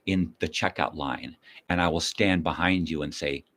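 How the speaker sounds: ordinary talk, nothing marked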